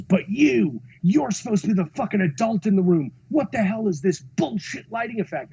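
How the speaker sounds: imitating a boss tearing into someone; retold angry yelling. Producer turns on the cinematographer, retold angry yelling. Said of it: "Sean is screaming at us".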